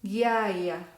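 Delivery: ghiaia with hard G, gyaya; Italian gh. Shown as a demonstration. Teacher models the Italian gh for students. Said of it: "Voy a pronunciar".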